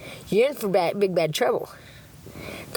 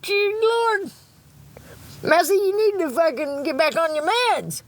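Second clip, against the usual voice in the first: scolding herself; 6 semitones higher than usual. Retold or imitated. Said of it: "And I was like".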